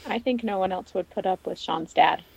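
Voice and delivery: ordinary talk, nothing marked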